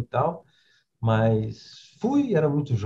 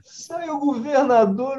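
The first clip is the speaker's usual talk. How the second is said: astonished; slower than usual. Retold or imitated. Shocked at seeing the governor.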